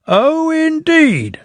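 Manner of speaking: high, slow, drawn-out severe indeed; mock-stern and drawn out. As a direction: stretch it out sternly, unimpressed. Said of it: "said the Fat Controller severely".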